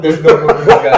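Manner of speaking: laughing repeat. Real, not acted. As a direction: repeat it cracking up at the memory. Friend repeats line, laughing.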